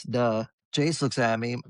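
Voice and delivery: normal talking voice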